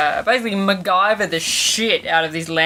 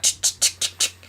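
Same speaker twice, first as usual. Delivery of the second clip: teeth-held chatter; 3 dB quieter, faster than usual. Shown as a demonstration. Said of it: "so I'm like".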